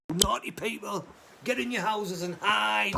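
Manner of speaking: high, loud mock scolding; mock judging. Good little sheep judging people who go out, mock telling-off. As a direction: scold them in a high, hammed-up shout. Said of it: "this is me judging them".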